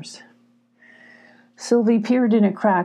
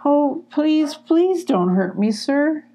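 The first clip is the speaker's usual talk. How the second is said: pleading; 8 semitones higher, slower than usual. Acted. Ferret begs badger.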